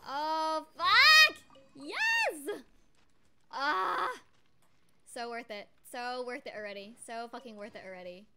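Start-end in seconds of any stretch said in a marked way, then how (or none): 0.1-4.1 excited screaming. Real, not acted. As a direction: scream with joy. Another lucky pull, screaming.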